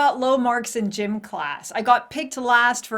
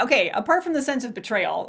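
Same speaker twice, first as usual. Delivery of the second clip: ordinary talk, nothing marked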